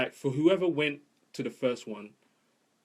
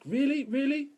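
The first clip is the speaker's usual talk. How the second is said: skeptical woman; 14 semitones higher, 3 dB louder than usual. Retold or imitated. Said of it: "one woman that was kind of like".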